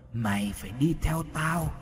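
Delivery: distant, reverberant ghostly command; eerie voice echoing out of a possessed child; echoing otherworldly voice. A narrator's reading. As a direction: sound as if calling from far away. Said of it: "mồm bật ra âm thanh vang vọng lắm".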